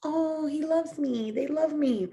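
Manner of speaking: high cooing delight; delighted high squeal. Retold or imitated. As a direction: coo it high like a smitten child. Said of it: "I was like".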